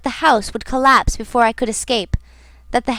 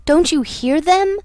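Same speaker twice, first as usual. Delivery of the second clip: crying out; 5 semitones higher than usual. A narrator's reading. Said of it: "I was on the point of crying at her".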